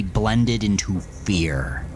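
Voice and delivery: plain reading voice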